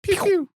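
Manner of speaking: high, sharp "pew pew"; imitated silenced gunshots. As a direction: make little cartoon gun noises.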